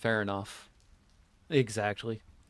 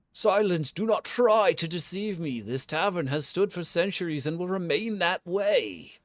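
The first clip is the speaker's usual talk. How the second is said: loud, raised-pitch imperious outburst; furious guardian spirit; 8 semitones higher, 7 dB louder than usual. Acted. Tavern guardian rebuking a suspected looter, furious shouting. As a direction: cut them off and thunder it out.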